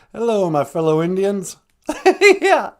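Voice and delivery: normal talking voice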